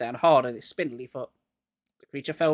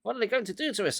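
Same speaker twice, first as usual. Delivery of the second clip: cried out; 7 semitones higher, faster than usual. A narrator's reading. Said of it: "I cried".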